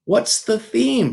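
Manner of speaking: put-on high, slow little girl question; an eager small girl asking. A narrator's reading. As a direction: Sweetie Pie asking about the theme.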